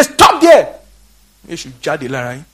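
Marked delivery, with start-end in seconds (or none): 0.0-0.8 high, loud stop command; retold shout. Preacher orders people leaving the meeting to stop, shouting high. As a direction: yell it to halt the crowd.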